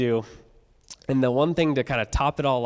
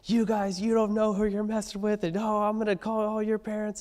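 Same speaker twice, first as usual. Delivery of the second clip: much higher, strained retold scream; teacher screaming; 10 semitones higher than usual. Teacher catching students trashing her yard, high-pitched screaming. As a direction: squeal it out like an outraged teacher. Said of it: "she just starts screaming".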